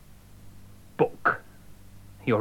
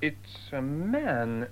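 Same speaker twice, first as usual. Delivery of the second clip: slow, hushed shock at the sight; stunned; 3 semitones higher, 5 dB quieter, much slower than usual. Acted. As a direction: let the words drop out slowly in shock. Shocked discovery of a body.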